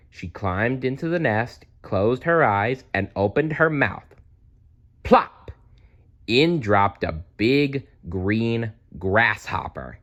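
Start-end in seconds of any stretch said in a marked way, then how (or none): 5.0-5.5 high, louder plop sound; comic plop. A narrator's reading. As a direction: pop it out high and loud. Narrator voices the food drop.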